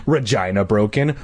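plain reading voice

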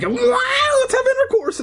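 very high squeaky cat voice. Retold or imitated. The cat propositioning her owner in a squeaky voice. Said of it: "then she's like".

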